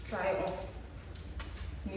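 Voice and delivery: slow whispered aside; hushed slow aside. Real, not acted. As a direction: breathe it out slowly to yourself. About to put jersey on.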